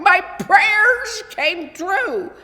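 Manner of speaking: put-on high, slow, thrilled child voice; overjoyed. A narrator's reading. Boy thanking heaven for his gift, thrilled and high. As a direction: savour each word with delight.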